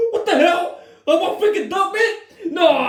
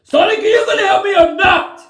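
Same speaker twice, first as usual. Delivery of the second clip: angry demand; 4 semitones higher, 6 dB louder than usual. Acted. Knuckles demanding help.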